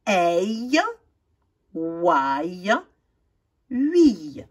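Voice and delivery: EI, OUAI, UI drawn out one by one; model sounds. Shown as a demonstration. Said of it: "Complète les mots avec".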